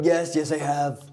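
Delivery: lightly put-on presidential voice; Donald Trump. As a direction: answer as a self-satisfied politician impression. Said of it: "let's leave President Trump".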